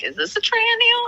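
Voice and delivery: high squeaky mock question; mock puzzled squeak. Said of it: "someone picks up a water glass and they're like".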